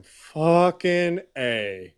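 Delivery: exclaimed. Real, not acted. Exclamation at list end.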